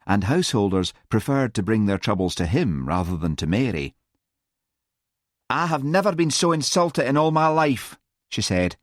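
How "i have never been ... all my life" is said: high, indignant sing-song whine; insulted. A narrator's reading. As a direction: protest in a high, affronted voice. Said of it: "Mary could hardly believe her ears".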